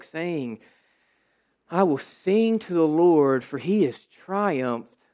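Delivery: hushed, reverent reading of the verse; quiet reverent Bible reading; soft scripture reading. Acted. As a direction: read it low and gentle, like a prayer.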